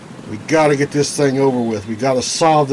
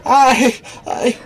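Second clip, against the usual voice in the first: panicked stammer; 11 semitones higher, slower than usual. Acted. Stammering denial under pressure.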